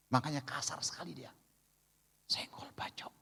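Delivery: unvoiced whisper; conspiratorial whispered joke; whispered aside. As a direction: drop to a secret whisper. Aside on a hot-tempered wife.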